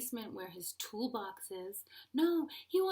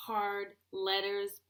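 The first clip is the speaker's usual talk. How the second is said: ordinary talk, nothing marked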